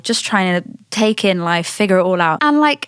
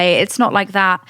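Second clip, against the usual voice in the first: ordinary delivery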